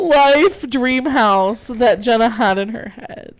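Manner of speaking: high, slow, emotional finish; choked-up, drawn-out delivery; tearful. Real, not acted. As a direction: force the line out slowly, overcome. Finishes line while overcome.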